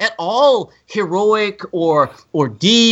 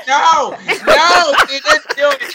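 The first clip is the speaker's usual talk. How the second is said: mock crying; 8 semitones higher, 4 dB louder, slower than usual. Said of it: "I was like".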